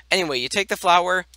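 normal talking voice

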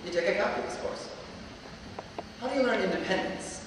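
ordinary talk

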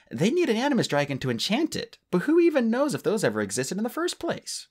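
light Tsunami voice, doubting; lightly voiced Tsunami.

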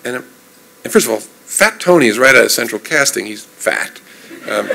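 normal talking voice

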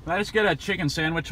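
normal talking voice

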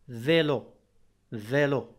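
فيلو with a clear v sound, in French style; French pronunciation. Shown as a demonstration. Teacher showing students the v in vélo. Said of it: "ينطق دائمًا في".

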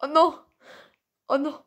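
normal talking voice

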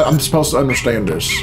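ordinary talk, nothing marked

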